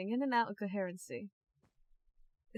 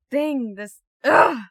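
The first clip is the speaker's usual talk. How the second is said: words break into a loud angry groan; exasperated cry of ugh; frustrated outburst; 5 semitones higher, 14 dB louder, slower than usual. Acted. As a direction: burst out in frustration, much louder. Doctor struggling to explain, frustrated.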